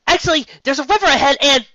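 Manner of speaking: high loud call. Acted. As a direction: shout while running. Twilight calling out.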